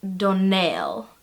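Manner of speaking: "Donnell" drawn out slowly, ending stressed; last-syllable stress. Retold or imitated. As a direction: pointedly say don-NELL for "Donnell". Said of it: "is on the last syllable".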